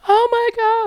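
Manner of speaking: high excited screaming. Retold or imitated. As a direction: shriek it in disbelief. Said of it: "I start screaming and crying".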